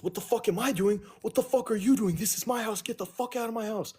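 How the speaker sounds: high-pitched, indignant mock rant; imitating angry outburst. Partner snaps back at the speaker's dad, high-pitched imitated outrage. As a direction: act out his angry comeback, pitched well up. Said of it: "Just like that".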